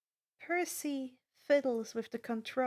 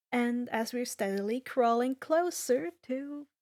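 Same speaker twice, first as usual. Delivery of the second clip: ordinary delivery, nothing marked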